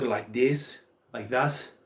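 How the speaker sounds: a light imitation Irish accent; hint of Irish brogue on the vowels. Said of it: "doing an Irish accent, or a brogue".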